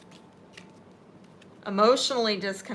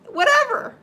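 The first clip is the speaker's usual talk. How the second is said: Whatever thrown out high and loud; emphatic whatever; 10 dB louder than usual. Real, not acted. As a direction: toss the word Whatever up high. Emphatic echo of careless answer.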